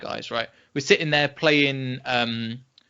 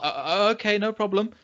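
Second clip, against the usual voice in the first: stammered, higher-pitched okay; hesitant agreement; 6 semitones higher than usual. Retold or imitated. Podcaster recalls reacting to the British guy's order, hesitant. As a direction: go along with it, unsure.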